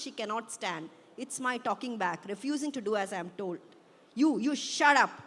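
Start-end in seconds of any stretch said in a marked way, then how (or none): 4.2-5.1 loud, higher, stammered retort; enraged yell. A narrator's reading. Daughter yelling back at her mother in rage, loud. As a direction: yell back, stumbling on the first word. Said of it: "I yell back at her louder. I'm shaking in rage now".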